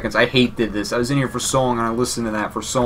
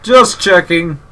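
higher, louder cheeky villain line; mock villain quip; 7 semitones higher, 7 dB louder, much slower than usual. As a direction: toss it off like a smug villain. Voicing the villain's punchline.

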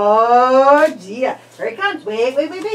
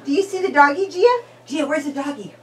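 ordinary talk

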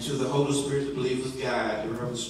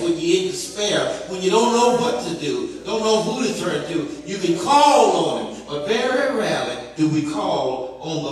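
raised, driving preacher's delivery; fervent preaching; 4 semitones higher, 6 dB louder than usual. Real, not acted. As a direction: build each promise higher and louder. Preacher builds at full force.

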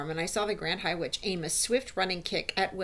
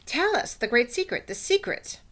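high, insistent witches' cry; eager plea; 6 semitones higher, 4 dB louder than usual. Acted. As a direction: beg excitedly in a higher voice. Audience begs for the secret.

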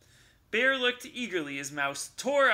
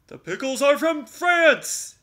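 loud, higher grand declaration; bear declaring; 10 semitones higher, 11 dB louder than usual. Acted. Bear declaring to Mouse, loud and grand. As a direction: announce it like it matters. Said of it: "declared the bear".

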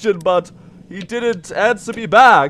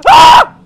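short high, loud scream; startled scream; 9 dB louder than usual. Real, not acted. Player searching for squirrels is startled, short scream. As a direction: scream in sudden alarm.

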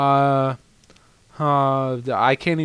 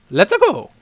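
high, loud cartoon call; short, high, punchy exclamation; Mario-style rallying cry; 6 semitones higher, 5 dB louder than usual. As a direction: call out an eager charge. Reading Mario's battle cry.

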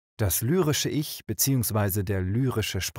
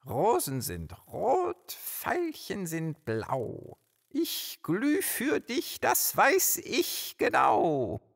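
high, slow, swooning recitation; hammed-up romantic verse, stretched and lilting; lovestruck poet; 8 semitones higher, much slower than usual. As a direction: draw out the love poem with soaring pitch. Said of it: "möchte seinem Schatz ein romantisches Gedicht schicken".

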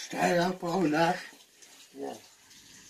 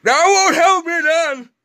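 mock protest; 9 semitones higher, 4 dB louder, slower than usual. Mock complaint at sibling.

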